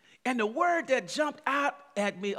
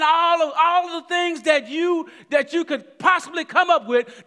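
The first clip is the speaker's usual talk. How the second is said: loud, high, building preacher's call; full-throated sermon climax; preaching at full force; 8 semitones higher, 9 dB louder than usual. Acted. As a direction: drive it home to the congregation.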